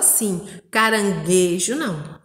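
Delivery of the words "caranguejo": caranguejo with a strong GUE, the U silent; stressed sound demo. Shown as a demonstration. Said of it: "não conseguiu ouvir o som".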